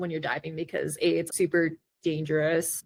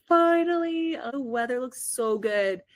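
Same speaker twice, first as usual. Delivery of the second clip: relieved delight; 6 semitones higher than usual. Retold or imitated. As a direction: retell a happy, relieved outburst, pitched up. Retelling delight at clear weather.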